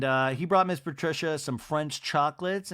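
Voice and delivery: ordinary talk, nothing marked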